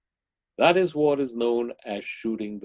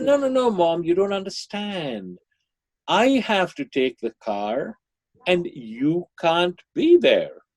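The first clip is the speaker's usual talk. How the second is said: put-on insistent teenage protest; 7 semitones higher, 3 dB louder than usual. Son to his mother about the car, insistent protest. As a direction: act out a teenager insisting to Mom.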